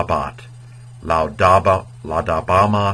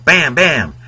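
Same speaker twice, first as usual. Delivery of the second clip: loud, high cartoon "Bam, bam!"; Fred Flintstone bam; 7 semitones higher, 6 dB louder than usual. Teacher hams a Flintstone shout for students, loud cartoon call. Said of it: "very Fred Flintstone".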